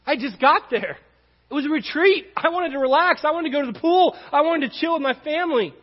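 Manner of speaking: playful self-mocking gripe; mock whine. Real, not acted. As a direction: whine about the lost pool day. Whining about lost vacation.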